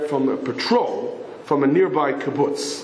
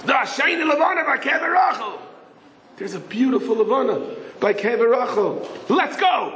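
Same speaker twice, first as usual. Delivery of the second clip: high-pitched, eager exclamation; thrilled announcement; 8 semitones higher than usual. Retold or imitated. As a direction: share the great news and urge him on. Yossel excitedly tells David.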